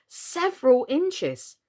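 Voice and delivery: ordinary delivery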